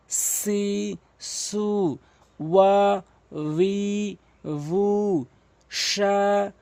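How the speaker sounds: long, level vowels from "কা, কি, কু" to "আ, ই, উ"; chant-like madd drill, stretching "লা, লি, লু" and the rest evenly; syllable reading drill. Shown as a demonstration. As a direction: recite each syllable such as "মা, মি, মু" separately and drawn out. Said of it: "এভাবে যদি আপনি পড়তে পারেন".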